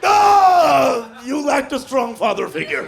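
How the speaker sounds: hammed-up progressive victim yelling; mock stabbing victim. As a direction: shout a calm sociology lesson mid-attack. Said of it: "logical and progressive during his own stabbing".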